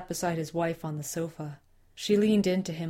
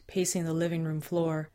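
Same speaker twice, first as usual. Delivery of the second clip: ordinary delivery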